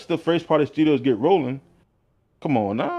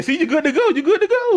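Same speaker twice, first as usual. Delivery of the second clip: excited hype; 4 dB louder than usual. Real, not acted. Hyping up the donations.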